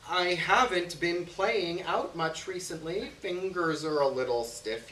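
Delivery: reluctant, drawn-out explanation; stalling excuse. Acted. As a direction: stretch out the excuse, pitch lifted. Making excuses for not playing.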